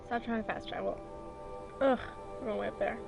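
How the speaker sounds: ordinary delivery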